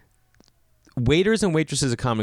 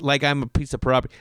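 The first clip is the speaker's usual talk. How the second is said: normal talking voice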